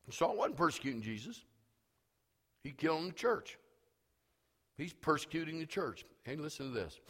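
usual speaking voice

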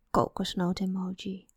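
sullen mumble. A narrator's reading. Ten-year-old muttering back at her would-be stepmother, soft mumble. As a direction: mumble it under your breath. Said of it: "mompelde ze weer".

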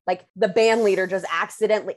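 normal talking voice

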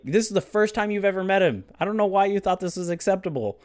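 imitated indignant lecture; mock scolding. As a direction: tell off a stranger in disbelief. Imitates friend scolding himself afterwards.

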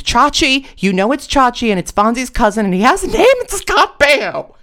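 high, loud, furious scream; screaming it, slowing right down on the last line. A narrator's reading. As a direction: shriek the name out in outrage. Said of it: "Liz screamed".

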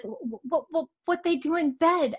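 high, halting stutter on each w; stuttering Mary. Retold or imitated. Podcaster retelling Mary's shy question to her cohost, high stutter. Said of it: "Mary has a little bit of a stutter".

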